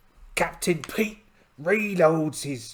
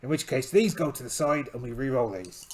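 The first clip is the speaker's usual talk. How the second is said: usual speaking voice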